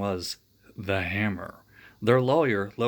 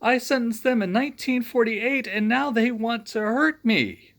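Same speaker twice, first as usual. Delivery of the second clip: high, loud frightened pleading; terrified; 11 semitones higher, 5 dB louder than usual. Acted. Judge pleading with the Ghostbusters, terrified. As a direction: plead in a panic.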